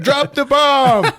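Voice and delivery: slow, high, loud drawn-out shout; mock yell, laughing. Cobra yelling at the gunman, slow high mock shout. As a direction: stretch the yell out.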